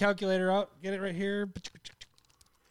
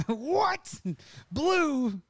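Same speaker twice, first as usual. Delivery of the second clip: mock outraged listener; 7 semitones higher than usual.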